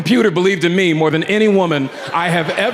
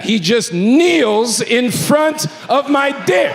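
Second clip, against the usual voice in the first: rising, drawn-out disbelief; incredulous slow reveal; 6 semitones higher, much slower than usual. Retold or imitated. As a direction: space out each phrase in mock horror. Comic reveal after expected pats.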